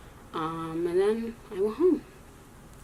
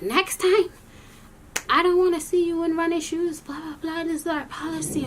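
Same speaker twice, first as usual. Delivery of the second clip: mocking teacher lecture; 8 semitones higher than usual.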